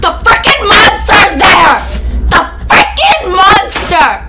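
frightened gamer yelling a warning; panicked yell. Real, not acted. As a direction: alarm at the monster, shouting it out. Spots the monster in game.

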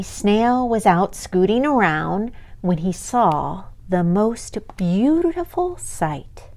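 usual speaking voice

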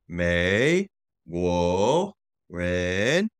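three separate long rising syllables, MEI GUO REN; tone demonstration. Shown as a demonstration. Teacher demonstrates full phrase tones.

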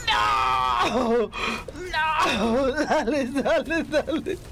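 high frantic cries; panicked yelling. Real, not acted. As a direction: shout no, then laugh-urge on. Pelea caótica con dinos. (gritos agudos de pánico)

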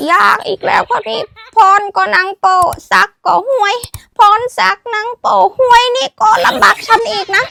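high, loud comic complaint; mock exasperation. Teacher complaining to viewer named Phonsak, mock exasperation.

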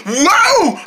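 ordinary talk

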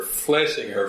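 ordinary delivery

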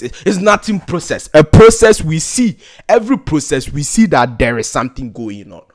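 ordinary delivery

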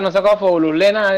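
ordinary talk, nothing marked

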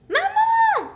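very high, loud cry for mum; child's shriek; calling out. Acted. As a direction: yell for mother across the house. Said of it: "crie-t-elle".

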